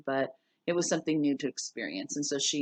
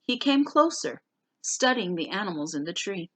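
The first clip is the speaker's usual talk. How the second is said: higher, livelier read-aloud voice for the class; story narration; 4 semitones higher, 4 dB louder than usual. A narrator's reading. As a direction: read the chapter to students with animated lilt. Reads novel chapter aloud.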